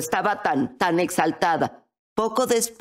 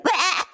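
short, high-pitched mock scream; quick yelp of fright, acted out; 12 semitones higher than usual. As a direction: imitate a startled shriek. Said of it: "Empezaron a gritar".

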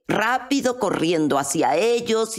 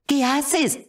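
mildly indignant, pitched higher; retold anger; 7 semitones higher than usual. Husband as his wife leaps from the car, angry. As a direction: imitate an exasperated husband challenging her. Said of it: "John se enfureció".

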